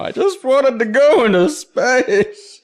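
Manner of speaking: very high, loud, drawn-out lament; mock wailed excuse. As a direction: ham up the excuse as a slow wail. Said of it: "every time I fuck something up now".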